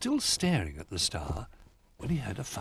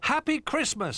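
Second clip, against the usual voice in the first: excited cry; 4 dB louder than usual. A narrator's reading. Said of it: "they cried".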